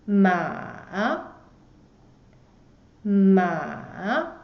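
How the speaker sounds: "ma" dipping down then rising; third tone ma. Shown as a demonstration. Teacher modelling the third tone, "ma" dipping and rising. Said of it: "goes down first and then go up".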